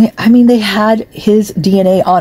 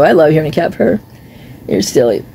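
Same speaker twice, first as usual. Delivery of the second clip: normal talking voice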